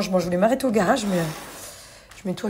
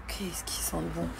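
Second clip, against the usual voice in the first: whispering; 3 semitones lower, 11 dB quieter than usual. Real, not acted. Savouring the smell.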